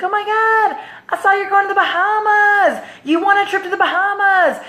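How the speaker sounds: high, gushing phone congratulations; imitated excited mom. Mom calling about daughter's trip, excited. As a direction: gush in a high-pitched mom voice.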